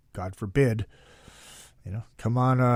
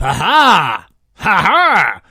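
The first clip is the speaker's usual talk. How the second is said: mock laugh; 3 semitones higher, 6 dB louder, much slower than usual. Real, not acted. Laughs after curveball joke.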